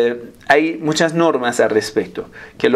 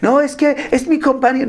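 much higher mocking whine; whiny excuse; 12 semitones higher than usual. Man excusing staring at his coworker, high whiny parody. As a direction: parody a man's lame excuse.

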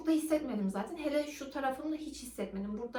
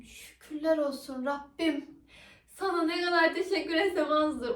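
mock prayer of relief; 4 semitones higher, 5 dB louder than usual. Retold prayer of relief at breathing.

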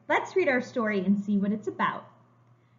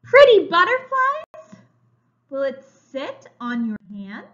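slow, bright storybook reading; reading to children; 4 semitones higher, 10 dB louder than usual. A narrator's reading. Teacher reading a picture book to young children, slow and bright. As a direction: read it like a picture book for little kids.

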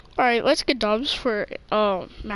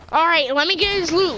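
ordinary talk, nothing marked

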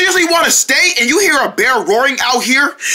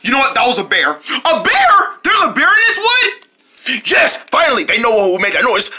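ordinary talk

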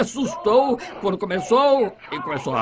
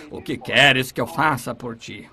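calm Jesus; 9 semitones lower than usual. Retold or imitated. Jesus answers the blind man.

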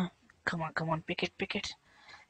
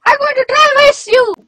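crying shout; 10 semitones higher than usual. Acted. Wife cries and shouts.

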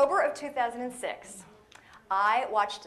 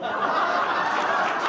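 laughs out loud at her own joke; a big unvoiced laugh spills out; laughing at her yellow car plate; real burst of laughter; 9 dB louder than usual. Real, not acted.

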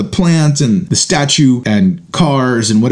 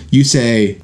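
ordinary talk, nothing marked

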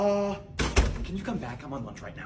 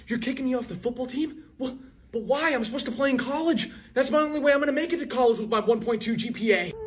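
desperate, upset protest, pitched up high; whiny athlete begging to stay on the team; higher, louder pleading; 9 semitones higher, 3 dB louder than usual. Acted. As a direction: plead to the principal, voice rising in distress.

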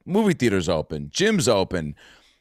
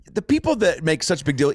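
ordinary talk, nothing marked

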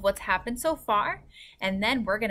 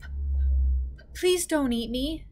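higher-pitched, scared stammer; frightened little plea with a stutter; 6 semitones higher, 3 dB louder than usual. A narrator's reading. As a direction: stammer on the first sound, pleading. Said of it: "Sophie stammered".

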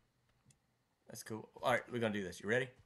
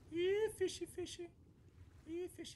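high cooing voice. Pretend. Cooing at fish in game.

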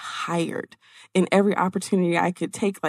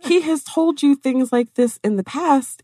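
self-scolding; 6 semitones higher, 4 dB louder than usual. Retold or imitated. Scolding herself at desk.

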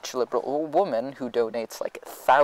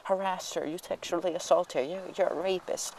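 higher, mostly softer mock outrage; mock outraged girls; 6 semitones higher, 5 dB quieter than usual. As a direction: parody their accusing chorus. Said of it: "started yelling and being like".

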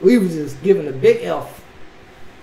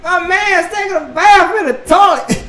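loud, high-pitched disbelief; incredulous shout; 11 semitones higher, 7 dB louder than usual. Real, not acted. Vlogger reacting to a bath in the toilet, incredulous shout. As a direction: holler it at the absurd sight.

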